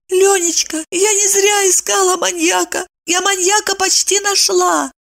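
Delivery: very high, meek justification; pleading wife. A narrator's reading. Wife explains herself to silent husband, pleading. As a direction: explain yourself in a tiny high voice.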